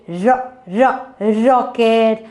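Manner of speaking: "Ro, ro" as separate short beats, then "rocket"; sounding out. Shown as a demonstration. Teacher helps children hear R in rocket, sounding out. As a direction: isolate the first sound of "rocket" twice. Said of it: "Which has rock, raw sound?".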